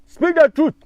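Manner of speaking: high, loud call for the truth; loud rally shout; shouted demand. Real, not acted. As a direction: belt it out like a rally cry.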